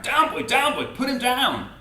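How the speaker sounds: high, loud order to an animal; angry command. A narrator's reading. Keeper ordering the lion off Jim, angry shout. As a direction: shout it like scolding a dog. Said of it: "he cried with angry frown".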